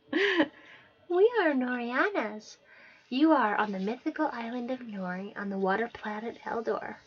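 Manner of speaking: a sly little creature announcing itself. Acted.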